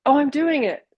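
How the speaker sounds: bright, high, chirpy little "oh, I'm doing it"; pleased with herself. Retold or imitated. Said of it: "I'll be like".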